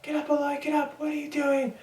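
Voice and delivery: high-pitched imitation of screaming drill instructors; mock screaming. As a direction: mimic a scream in a high, strained voice without full volume. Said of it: "my drill instructors were screaming at me".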